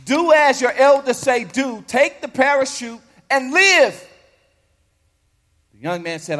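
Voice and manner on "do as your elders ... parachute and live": rising to a frustrated, louder command. Acted. Preacher to student, frustrated loud order. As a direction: push it, an elder losing patience. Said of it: "The preacher was getting frustrated with him".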